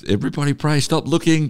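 high-pitched mock shout at normal volume; imitated shout. Interviewer echoing her plea to pray, imitated shout. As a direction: mimic someone urgently appealing to bystanders. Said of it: "you literally were shouting this out".

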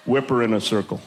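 low, sly comic voice; scheming friend. Acted. Friends to the boat driver about the skier, low and scheming. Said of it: "They look back and go".

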